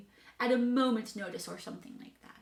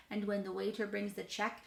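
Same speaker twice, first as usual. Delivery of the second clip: usual speaking voice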